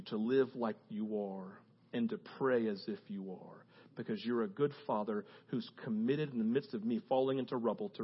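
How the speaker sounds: low, soft and steady; hushed, earnest words to God; quiet trusting prayer. Shown as a demonstration. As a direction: pray it gently, close to a murmur. Models a calm trusting prayer.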